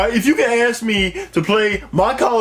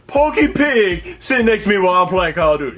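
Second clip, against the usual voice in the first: ordinary talk, nothing marked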